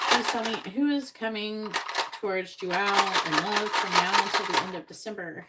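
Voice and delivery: loud, deliberate question to the cards; loud ritual asking of the deck; formal invocation. Acted. Asks the cards her question.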